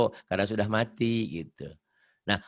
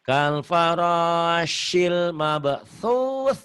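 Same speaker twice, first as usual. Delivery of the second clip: very slow recited verse; measured Quran recitation; slow recitation demo; 3 semitones higher, 3 dB louder, much slower than usual. Shown as a demonstration. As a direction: draw out each word for the student. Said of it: "mabthusnya jadi apa".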